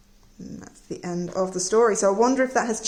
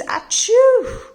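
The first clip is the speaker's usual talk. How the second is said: loud, high acted sneeze; a theatrical achoo, much louder; big sneeze; 7 dB louder than usual. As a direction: let out a huge fake sneeze.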